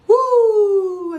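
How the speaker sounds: high, loud shaky exhale of emotion; real emotion surfacing, releasing it; emotional woo. Real, not acted. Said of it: "I can feel the emotions coming back".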